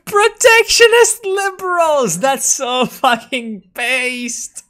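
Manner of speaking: very high laughing falsetto, slowed; high laughing delight; squealing laugh. Real, not acted. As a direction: giggle it out in a shriek. Laughing at party platform.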